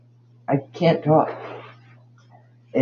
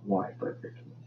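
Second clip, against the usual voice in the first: soft whisper, much softer; whispered why; 3 semitones lower, 16 dB quieter, faster than usual. Real, not acted. Vlogger muttering to viewers about the crowns, whispered. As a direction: breathe it out in defeated disbelief. Trailing off in disbelief.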